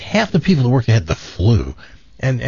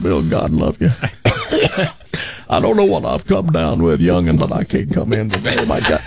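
sick Davy Lee; 8 semitones lower than usual. Retold or imitated. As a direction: play sick on the phone, folksy Southern. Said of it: "a great Davy Lee".

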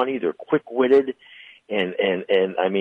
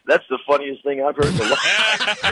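high-pitched, tickled delivery; amused, pitched up through laughter; laughing to tears; 7 semitones higher than usual. Real, not acted. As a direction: say it cracking up for real. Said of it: "I'm crying right now from laughing".

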